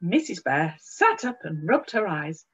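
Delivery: plain reading voice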